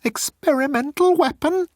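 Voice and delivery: squeaking high-pitched alarm; squeaked. Acted. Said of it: "Moan squeaked".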